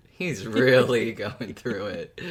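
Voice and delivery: laughing through the words, drawn out slowly. Real, not acted. To the co-host, laughing about Arthur's ordeal. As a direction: let a real laugh break into the line.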